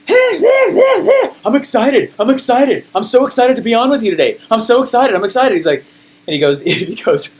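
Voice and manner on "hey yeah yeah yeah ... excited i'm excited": squealing high parody of an excited presenter; mock hype. Jerk imitating American sellers on stage, hammed-up excitement. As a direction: gush it high-pitched, hammed-up and over-eager. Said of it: "that stupid and hypey".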